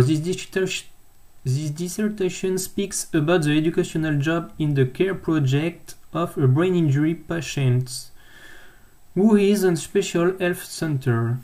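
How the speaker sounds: careful English read-aloud with a French accent; self-mocked English accent. Real, not acted. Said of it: "avec mon super accent anglais".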